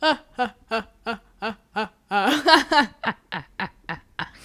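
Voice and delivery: a hammed-up "ha, ha, ha" cackle, staccato; mock forced laugh. Mister Saxton laughing alone after being rejected, mock laugh. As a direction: fake a hollow, spurned laugh.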